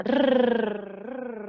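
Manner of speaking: long tongue-tip trill; drawn-out trilled "rrr"; Spanish rolled R trill. Shown as a demonstration. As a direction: roll a sustained Spanish R. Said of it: "la letra R vibra en la punta de la lengua".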